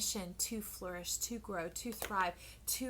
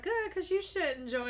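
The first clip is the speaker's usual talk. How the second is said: cheeky high reply; 10 semitones higher, 8 dB louder than usual. Retold or imitated. Viewers teasing reader, high loud cheeky. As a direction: tease back with a grin. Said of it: "You're like".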